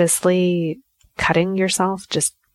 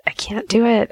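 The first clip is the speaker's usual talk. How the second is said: higher-pitched, protesting cry of defeat; mock panic; 5 semitones higher than usual. Talking herself out of pressing the lancet button, panicky. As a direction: let the voice jump up as nerve fails.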